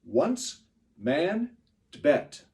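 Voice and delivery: words punched high and loud on the beat; stressed words on the beat. Shown as a demonstration. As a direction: hit each stressed word hard. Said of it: "so the first one goes".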